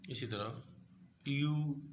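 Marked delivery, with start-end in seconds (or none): 1.3-1.8 यू said high and loud with a rounded vowel; Turkish ü. Shown as a demonstration. Teacher to students, यू called out high and loud. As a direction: sing out यू with rounded lips. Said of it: "ऊ करके इसको पढ़ते हैं".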